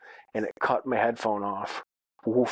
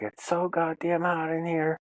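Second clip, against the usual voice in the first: raised pitch, imitating an annoyed daughter; irate daughter; 6 semitones higher than usual.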